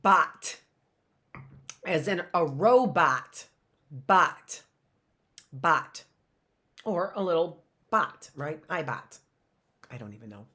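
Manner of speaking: ordinary talk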